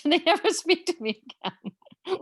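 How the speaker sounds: laughing through the punchline. Real, not acted. Playwright joking about her kids, laughing. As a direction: giggle the words out.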